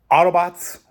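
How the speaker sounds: high, loud heroic battle call; rally the troops like a robot leader; Optimus catchphrase. Pretend. Signing off the video as the Autobot leader, a loud heroic call. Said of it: "Autobots, roll".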